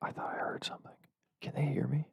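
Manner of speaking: wary, very soft whisper. Real, not acted. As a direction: whisper in case someone can hear. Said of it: "Sorry, guys, I'm whispering".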